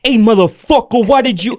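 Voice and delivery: loud mock furious yell; angry agent. Listing agent yelling at the careless realtor, loud mock fury.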